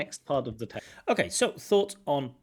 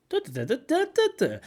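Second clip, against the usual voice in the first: much higher and louder bouncing da da; happy intonation; 14 semitones higher, 5 dB louder, faster than usual. Shown as a demonstration. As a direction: lift the melody up with joy. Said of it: "something happy".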